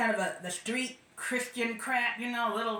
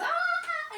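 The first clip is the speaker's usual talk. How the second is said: mock crowd gasp. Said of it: "everybody goes".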